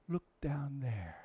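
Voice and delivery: slow hushed whisper. Retold or imitated. Monkey showing Simba the pool, whispering. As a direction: breathe it like a secret.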